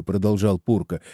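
ordinary delivery

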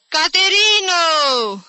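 long, high, loud drawn-out call; slow hollered summons; calling from outside. A narrator's reading. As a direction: sing out the name to someone indoors. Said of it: "strigă tanti Agepsina de afară".

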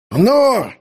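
high, loud angry bark; furious roar. Acted. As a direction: roar it out in fury. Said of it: "Яростный рык". (яростный рык)

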